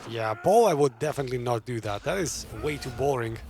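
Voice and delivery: ordinary talk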